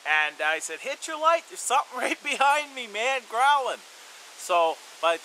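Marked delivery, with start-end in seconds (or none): 0.8-3.8 higher, loud, with a nervous laugh; repeated urgent yell. Retold or imitated. Repeating the call to friend, louder and more urgent. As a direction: shout again, more urgent and half laughing. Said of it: "me yelling".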